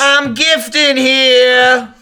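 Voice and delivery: loud, drawn-out East Coast holler; East Coast accent. Pretend. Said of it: "Was it the East Coast?".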